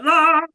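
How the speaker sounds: loud high wordless bellow; big open-throated "aah"; bellowing yell. Acted. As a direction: bellow into the void. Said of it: "It's like bellowing into the void".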